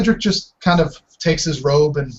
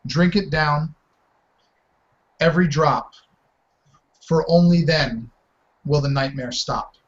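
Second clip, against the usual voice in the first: reading a rhyme. Acted. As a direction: recite the riddle like an incantation. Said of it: "pardon me while I read it".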